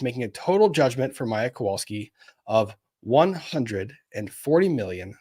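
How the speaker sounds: normal talking voice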